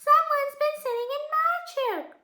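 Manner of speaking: very high little cub voice; high-pitched storybook cub cry; squeaky Baby Bear. A narrator's reading. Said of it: "cried Baby Bear".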